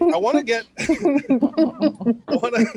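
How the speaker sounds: laughing through the words; breaking up mid-sentence. Real, not acted. Laughing at own joke.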